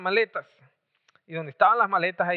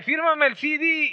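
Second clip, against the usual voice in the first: high, drawn-out fan plea; mock an excited autograph hunter, pitched way up; imitated eager fan; 8 semitones higher than usual. Fans begging for autographs.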